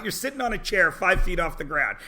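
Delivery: ordinary delivery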